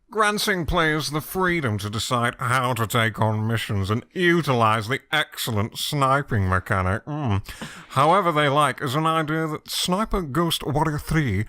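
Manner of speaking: lightly voiced Alan Partridge, slightly slow and measured; a hint of Alan Partridge reading the review; Alan Partridge impression. Host reads a game review as Alan Partridge, lightly voiced. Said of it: "halfway through the Partridge impression".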